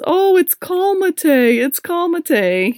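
high, slow teasing singsong; teasing husband. Retold or imitated. Husband teasing her when passing the gas station, high slow singsong. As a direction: tease her like the husband does. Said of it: "my husband says".

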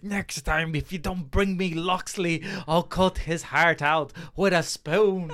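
slow, raspy villain's threat in a put-on voice; imitating a movie villain's rasp; weird raspy voice. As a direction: rasp it out like a sneering henchman. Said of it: "weird raspy voice for no reason".

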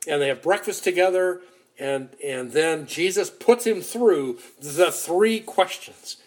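ordinary delivery

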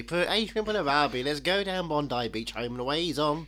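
hammed-up Australian accent, sung out; mock Australian accent. Joking Australian accent for the podcast co-host. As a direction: play up the Aussie clichés in an Australian twang. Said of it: "singing it in Australian accent".

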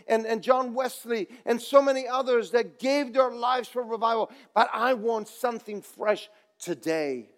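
full revival preacher; preaching. Acted. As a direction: preach with rising fervour. Preaching building to full force.

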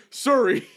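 "Siri" as a loud, high "Surry"; Sam's dad's "Siri". Retold or imitated. Friend mimicking Sam's dad calling the phone, loud odd "Siri". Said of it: "That's how Sam's dad says Siri".

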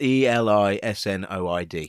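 letter by letter spelling. Shown as a demonstration. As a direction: spell each letter out clearly. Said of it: "which is spelled".

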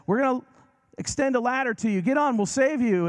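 high, loud bullhorn holler; hollering rescuer. Retold or imitated. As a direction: call down from a helicopter.

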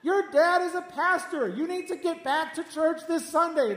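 high, loud mock reprimand; playful scolding. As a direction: scold her like a concerned pastor, hammed up. Acted scolding of the telemarketer.

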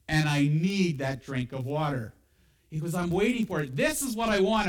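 loud, high-pitched pulpit preaching; fervent preaching. Acted. Pastor urging the congregation to expect answers, loud preaching. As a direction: preach it out with full force.

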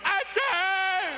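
high, loud yell; calling out. Retold or imitated. Kid chasing the ice cream truck, high loud yell. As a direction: shout after the truck.

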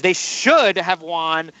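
"should" stretched and punched; stress on SHOULD. Real, not acted. Host on games Galaxy dropped, stressing "should". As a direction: lean slowly on "should". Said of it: "I'm gonna emphasize the word should".

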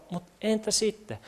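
quiet, indifferent question; unimpressed so-what. Acted. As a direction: drop to a very soft shrug. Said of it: "Eikö se vähän oo silleen?".

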